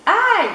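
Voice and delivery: sharp, very high, loud ouch; cry of pain. Acted. Chick yelping as the sheep trample him. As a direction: yelp under the hooves. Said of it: "s'écrie Poussin".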